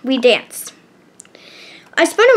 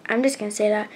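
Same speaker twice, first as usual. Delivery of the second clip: plain reading voice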